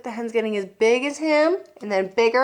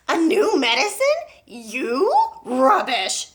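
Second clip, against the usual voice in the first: loud, mocking old woman's shout; scornful yell; 4 semitones higher, 5 dB louder, slower than usual. Grandma scoffs down at George from the roof, loud and scornful. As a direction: scoff it down at him. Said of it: "she yelled".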